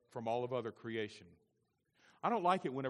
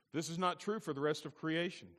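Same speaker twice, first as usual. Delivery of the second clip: normal talking voice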